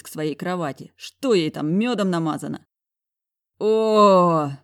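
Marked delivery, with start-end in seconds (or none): 3.6-4.5 loud pained "oh" after a blow below the belt; man groaning after a kick. A narrator's reading. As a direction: groan out the hurt. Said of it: "простонал я".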